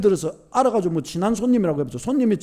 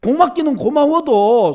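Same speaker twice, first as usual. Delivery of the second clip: usual speaking voice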